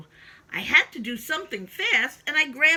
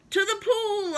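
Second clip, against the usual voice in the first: high, lightly raised shout; mild yell; 10 semitones higher than usual. Acted. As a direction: echo the firemen with a light yell. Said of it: "I yelled".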